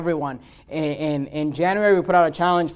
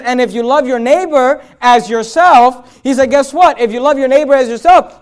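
higher, louder preaching, driving each line home; full-force preaching; 5 semitones higher, 7 dB louder than usual. Acted. Pastor preaching Jesus' words to the congregation, raised and forceful.

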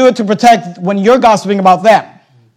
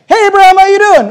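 high friendly hail; cheery greeting; 10 semitones higher, 4 dB louder than usual. Acted. Abimelech greets Abraham the next day, high and cheerful. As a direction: greet him brightly, high and loud.

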